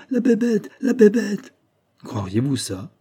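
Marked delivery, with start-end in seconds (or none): 0.1-1.5 high-pitched, imitated child's cry. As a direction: cry it out like a frightened little one. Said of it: "Il s'est débattu en criant".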